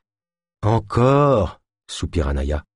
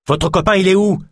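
high-pitched urgent cry; shouted question; 10 semitones higher, 4 dB louder than usual. A narrator's reading. Naya challenges the man about his companion, shouting. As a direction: shout it as a demand. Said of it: "cria-t-elle".

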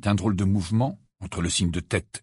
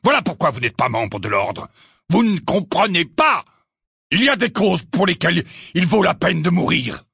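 high, loud, slowed shouting; furious shout; 9 semitones higher, 6 dB louder than usual. A narrator's reading. Sirius shouts at the twins, furious. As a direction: roar at them. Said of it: "tout aussi furieux".

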